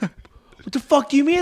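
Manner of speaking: high, loud disbelieving shout, laughing through it; half-laughing mock outrage; incredulous yell. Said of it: "And he goes".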